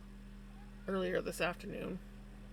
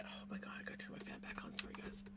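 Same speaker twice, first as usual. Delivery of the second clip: whisper; 17 dB quieter, much faster than usual. Real, not acted. Apologising while turning fan on.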